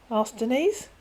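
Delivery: ordinary delivery, nothing marked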